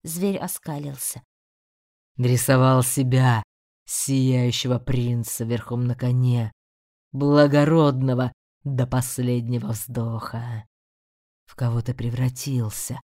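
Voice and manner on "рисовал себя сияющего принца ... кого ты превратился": low mocking demon; a demon scorning a thief. A narrator's reading. Demon taunts Rey. (низкий насмешливый голос демона)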